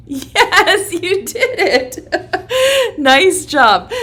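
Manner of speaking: loud, high, laughing praise. Real, not acted. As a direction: cheer them on with a laugh. Praising child's roar.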